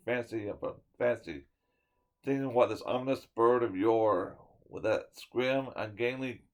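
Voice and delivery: ordinary delivery, nothing marked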